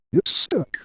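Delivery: high cry without extra volume; mild narrated scream. A narrator's reading. Rudy answers trapped Penny with a mild scream. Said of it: "Rudy screamed".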